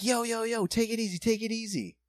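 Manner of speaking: re-enacted calm-down call; urgent caution. Retold plea to horsing kids.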